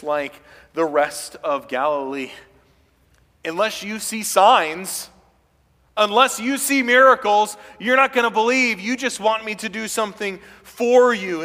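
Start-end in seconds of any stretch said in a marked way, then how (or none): 3.4-11.4 stern rebuke. Acted. Jesus rebuking sign-seekers.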